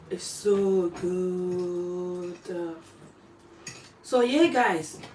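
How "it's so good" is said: very slow, drawn-out savoring. Real, not acted. Telling viewers about the food, slow savoring drawl. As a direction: stretch it out like the taste lingers. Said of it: "I wish you guys are here to try it".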